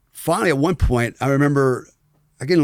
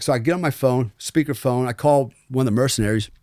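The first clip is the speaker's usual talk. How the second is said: ordinary delivery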